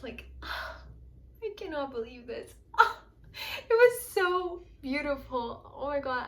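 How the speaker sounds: overcome, close to tears; tearful. Real, not acted. Reader moved to tears by the Queen of Cups, voice catching. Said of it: "I'm getting emotional now".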